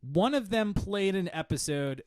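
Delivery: usual speaking voice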